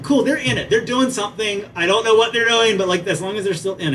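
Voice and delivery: a delighted teacher, pleased the groups are playing; excited teacher. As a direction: cheer that they're hooked. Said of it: "I'm like".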